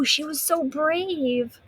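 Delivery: ordinary delivery, nothing marked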